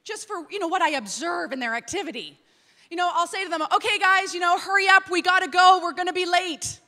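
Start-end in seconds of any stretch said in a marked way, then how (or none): 3.7-6.8 loud urging. Retold or imitated. Parent urging kids to hurry.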